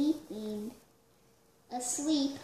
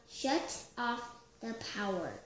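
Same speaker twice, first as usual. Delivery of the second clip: plain reading voice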